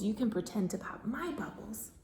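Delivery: soft hushed teacher whisper. Acted. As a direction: whisper to keep kids calm. Hushed instructions to children.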